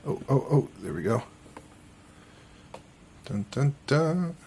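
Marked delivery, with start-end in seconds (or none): none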